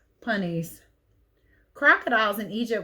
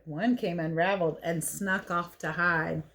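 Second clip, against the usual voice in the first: plain reading voice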